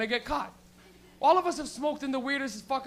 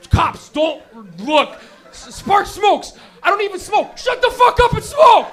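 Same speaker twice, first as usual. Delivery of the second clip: louder, higher, slow frantic yell; panicked yelling; 8 semitones higher, 14 dB louder, slower than usual. Retold or imitated. Teen in the car yelling at his friends, frantic. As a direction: panic at your friends as cops pass.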